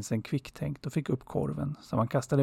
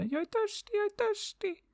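very high, tiny squeaky bird cry; little bird calling. A narrator's reading. Little yellow bird flies off calling out, very high and squeaky. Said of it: "flög iväg medan den ropade".

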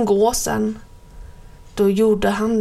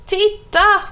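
put-on high excited shout of a child; high, loud kid's cry; children calling out; 10 semitones higher, 3 dB louder than usual. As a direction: yell it like a kid spotting something shocking. Schoolchildren shouting again.

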